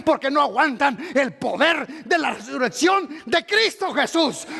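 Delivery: loud, high-pitched sermon cry; fiery preaching. Acted. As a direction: preach it with full force to the congregation. Preaching at full force.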